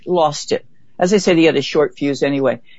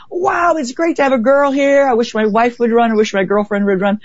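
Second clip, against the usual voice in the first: higher, lightly mocking men's welcome; cheery male runners; 7 semitones higher than usual. Men at the start line welcoming her, a light, higher imitation. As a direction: imitate the friendly men, pitched up.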